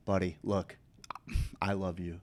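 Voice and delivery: low, hushed appeal to a buddy; weary plea. Retold or imitated. Telling a buddy he's too worn out, low and soft. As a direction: drop low and soft, placating. Worn-out teller pleading with customer.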